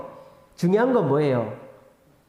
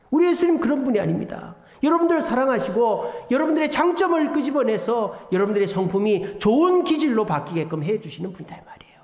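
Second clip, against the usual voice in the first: ordinary delivery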